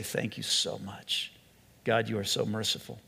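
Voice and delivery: low, hushed thanks to God; humbled prayer. Acted.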